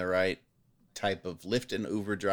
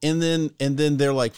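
normal talking voice

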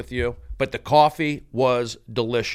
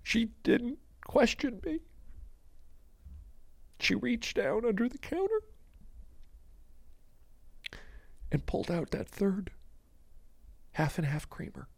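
tiny, high, hushed voice; hammed-up awe fading to a whisper; mock-emotional; 8 semitones higher, 14 dB quieter than usual. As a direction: play overcome, squeezing out each phrase softly. Climax of mock emotional scene.

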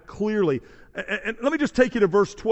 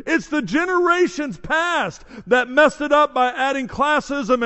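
emphatic, raised preaching voice; forceful preaching; 4 semitones higher, 5 dB louder than usual. Real, not acted. Preacher proclaims the gospel is for all, forceful preaching. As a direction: drive each phrase home to the congregation.

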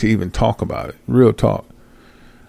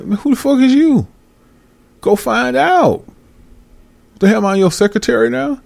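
incredulous outburst; 12 semitones higher than usual. Retold or imitated. A man rebuffing a friend who wants favours, high mock outrage. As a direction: snap back at the lazy asker. Angry retort to lazy asker.

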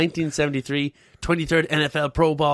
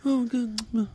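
much higher, whining; mock whiny Trump; 8 semitones higher than usual. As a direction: whine like a self-pitying president.

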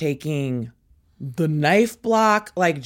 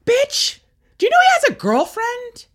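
incredulous retort, pitched way up; re-enacted jab, high and scandalized; high-pitched taunt; 4 dB louder than usual. Daughter confronts dad's new wife, high taunting retort.

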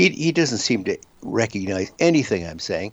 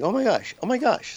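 amazed; 13 semitones higher than usual. Retold or imitated. Retold amazed thought.

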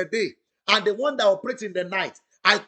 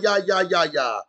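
ordinary delivery

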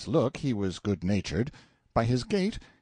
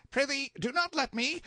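higher, hurried servant voice; a hint of a fussy undercook, pitched up; lightly voiced busy cook.

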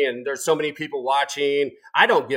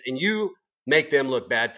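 ordinary delivery

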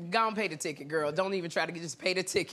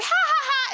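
high, loud fake giggle; exaggerated high-pitched ha-ha-ha; put-on flirty laugh; 13 semitones higher, 5 dB louder than usual. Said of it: "You'll be like".

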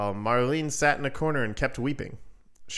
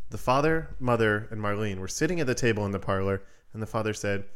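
ordinary delivery

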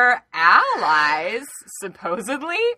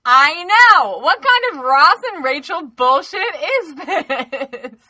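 louder and higher, laughing in disbelief; outraged laugh; 7 semitones higher, 8 dB louder, slower than usual. Real, not acted. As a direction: cry out in amused outrage.

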